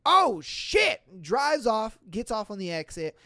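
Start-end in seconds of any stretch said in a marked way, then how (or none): 0.0-1.2 higher, louder shocked cry; mock startled shout. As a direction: yelp as the window blows. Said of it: "And he's like".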